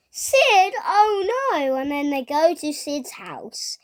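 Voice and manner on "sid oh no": high, loud mock alarm; alarmed Woody. Retelling Woody spotting Sid the toy-taker, high alarmed cry. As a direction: cry out in acted dismay. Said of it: "And then Woody goes".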